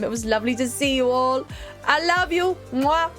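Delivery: usual speaking voice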